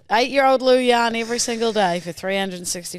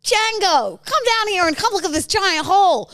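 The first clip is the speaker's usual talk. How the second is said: higher calling out, excited; 8 semitones higher than usual. Acted. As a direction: call them over excitedly. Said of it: "you're a scientist".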